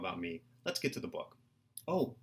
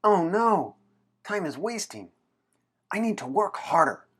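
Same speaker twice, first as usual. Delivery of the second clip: much higher, louder alarm; panicked; 7 semitones higher, 6 dB louder than usual. Acted. William realizing time is running out, panicked. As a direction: gasp it out in alarm.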